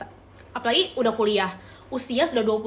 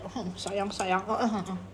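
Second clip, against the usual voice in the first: soft cooing comfort; 4 semitones lower, 7 dB quieter than usual. Real, not acted. Comforting a crying viewer.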